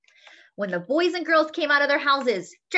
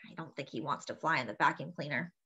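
softer, quicker repeated aside; quiet aside; 8 semitones lower, 8 dB quieter, faster than usual. Real, not acted. Quiet aside after growl.

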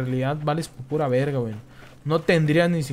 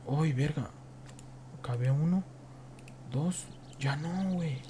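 whisper; 10 dB quieter than usual. Real, not acted. Sneaking up on an enemy.

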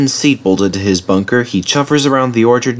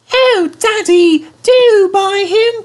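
put-on very high, drawn-out child voices; squeaky, slow, imploring whine; pleading boys; 4 dB louder, slower than usual. A narrator's reading. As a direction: beg Daddy like excited little boys. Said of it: "pleaded the boys".

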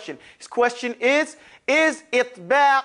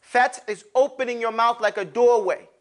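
ordinary delivery

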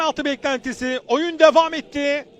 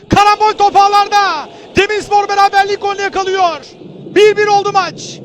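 loud excited goal call; screaming football commentator; 2 semitones higher, 5 dB louder than usual. Real, not acted. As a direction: shout the equaliser out. Equaliser goal called. (gol anında bağıran spiker)

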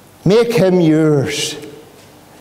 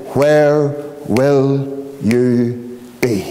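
solemn slow question; slower than usual. Acted. Preacher asking the congregation where they'll be, solemn and slow. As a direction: let each phrase hang in the air.

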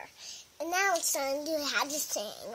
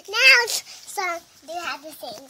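loud sing-song announcement; announcing; 11 dB louder than usual. Real, not acted. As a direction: belt it out like a show host.

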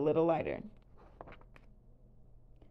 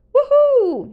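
very high, loud whoop; jubilant shout; 15 dB louder than usual. A narrator's reading. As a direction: let out a delighted cheer. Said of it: "he shouted".

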